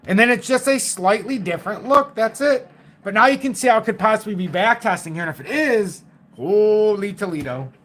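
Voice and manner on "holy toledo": slow, drawn-out exclamation of amazement; amazed exclamation. Real, not acted. Trader spotting a possible backtest on his chart, amazed. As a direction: stretch out HOLY in wonder.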